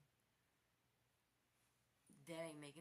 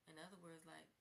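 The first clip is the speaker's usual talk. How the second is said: usual speaking voice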